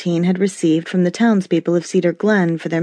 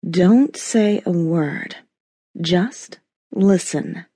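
sultry voice; slower than usual. Acted. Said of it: "she ordered in her sultry voice".

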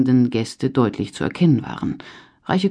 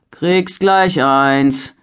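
leicht verstellte Knechtsstimme, langsam; beiläufig zurückrufen; rufender Knecht; 2 semitones higher, 5 dB louder, much slower than usual. A narrator's reading. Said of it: "rief er". (Knecht ruft beiläufig zurück)

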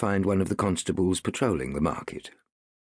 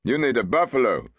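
helpful constable; 7 semitones higher, 5 dB louder than usual. A narrator's reading. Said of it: "said one of the constables helpfully".

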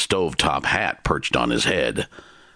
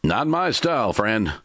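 Timber's reply; 7 semitones higher than usual. A narrator's reading. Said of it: "Timber said".

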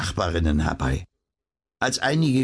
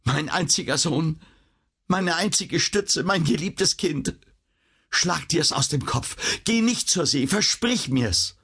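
pleading; 9 semitones higher than usual. A narrator's reading. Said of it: "hob in flehentlicher Bitte".

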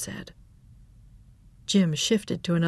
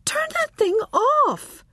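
alarmed; 3 dB louder, slower than usual. Acted. Irene alarmed.

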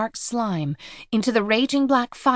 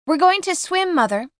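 high yell from a distance; 9 semitones higher than usual. Acted. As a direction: call back across the water. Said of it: "turns back and yells".

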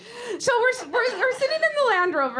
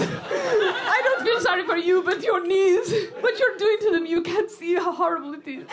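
laughing through mock crying. [name] lamenting over her knees.

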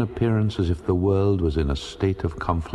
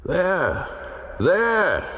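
sudden high cries of alarm; sailors shouting; 13 semitones higher than usual. Acted. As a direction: yell out and point, much higher. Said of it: "shouted the sailors".

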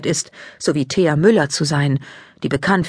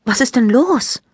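worried question; 7 semitones higher than usual. A narrator's reading. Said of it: "fragt Maike besorgt".

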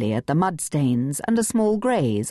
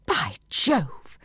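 exclamation; 2 semitones higher than usual. A narrator's reading. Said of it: "Bungie exclaimed".

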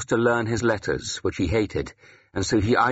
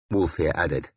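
plain reading voice

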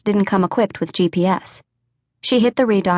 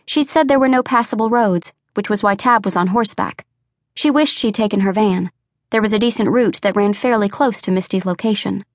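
ordinary delivery, nothing marked

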